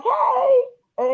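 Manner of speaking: high laughing greeting; a bright laughing hey; high-pitched giggly hello. Retold or imitated.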